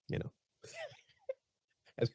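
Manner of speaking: breaks into high, helpless laughter mid-sentence; can't hold back the giggle while talking to viewers; laughing. Real, not acted. Host cracking up while introducing the case, laughing. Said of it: "apologize in advance for my laughing".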